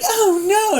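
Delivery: very high shocked "oh, no"; a sudden high cry of dismay at the maid's death. Retold or imitated. Mary reacts to drowned maid.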